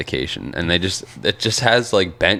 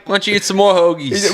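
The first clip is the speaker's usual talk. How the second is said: higher, hammed-up South Philly woman's voice; mock South Philly accent; 13 semitones higher than usual. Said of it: "South Philly accent".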